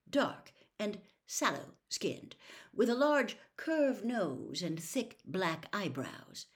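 plain reading voice

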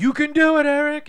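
high, loud cheering; mock cheerleading. Friend mocking a cheering character, high loud encouragement. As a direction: pump up a buddy.

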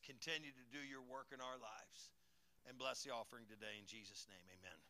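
hushed closing prayer before the offering. Acted. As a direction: pray gently over the offering. Leads a prayer.

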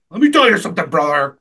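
rally the fans like an eighties wrestler; hammed-up wrestler hype in short bursts; Hulk Hogan. Shown as a demonstration. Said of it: "I can do an okay Hogan".

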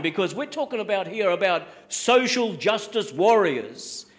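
ordinary delivery

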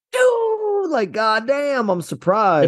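high-pitched amazed "Dude, like, goddamn", stretched slowly; mock surprise. Shown as a demonstration. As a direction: act amazed, voice jumping up high. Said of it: "I'm trying to be like".

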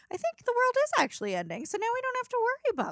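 high, fast, carefree mock voice with a laugh; breezy as if the end is a relief; mock tweeter. Mimicking tweeters who welcome the end, breezy and high. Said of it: "tweets that are like that".

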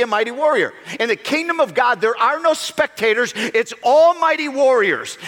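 loud, driving sermon voice; fiery preaching. Acted. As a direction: preach it hard to the congregation. Preaching at full force.

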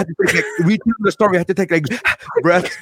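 lightly imitating someone too moved to talk; retold choked up. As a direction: act out getting choked up, pausing for breaths. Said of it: "choked up".

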